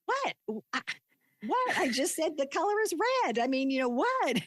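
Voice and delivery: high, bewildered mock protest; baffled husband. Retelling how her husband reacted to her tears, high and baffled. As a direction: imitate a husband who can't see the problem. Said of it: "he was just".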